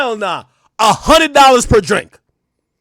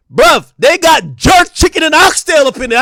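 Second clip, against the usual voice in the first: loud, high, fast burst of excitement; excited shout; 6 semitones higher, 4 dB louder than usual. Real, not acted. Streamer yelling to chat about jerk chicken, excited shout. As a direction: shout it out like you can't believe the menu.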